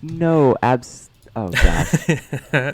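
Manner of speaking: real flustered dismay; embarrassed shock. Real, not acted. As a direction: squirm as the song comes up. Embarrassed at his song surfacing.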